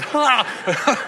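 a single loud, high "Ha!" of amusement; mock burst of laughter. Imitating the crowd laughing at Sarah, a loud high bark of laughter.

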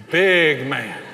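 BIG drawn out and loud; emphatic awe. Retold or imitated. Four-year-old looking the huge biker up and down, awed emphasis on BIG. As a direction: lean hard on BIG, as if sizing up a giant. Son repeats it with more force.

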